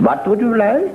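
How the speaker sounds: high, put-on innkeeper voice; a high sing-song barman greeting. Innkeeper greeting the traveller in a high voice.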